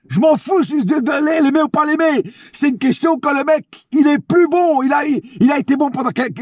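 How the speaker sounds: heated high-pitched rant; vent, pitch up and pushing hard; escalating comedy rant. Real, not acted. Heated rant about Hakimi.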